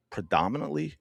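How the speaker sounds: ordinary talk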